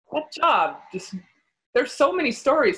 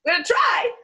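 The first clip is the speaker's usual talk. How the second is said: high, squeaky mock-hopeful answer; strained high reply; 10 semitones higher than usual. Performer answering agency asking for ten minutes, strained squeak. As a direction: squeak it out as an unlikely promise.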